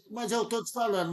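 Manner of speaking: normal talking voice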